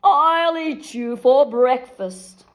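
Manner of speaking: slow, loud troll roar; troll challenging whoever crosses the bridge. A narrator's reading. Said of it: "roared the troll".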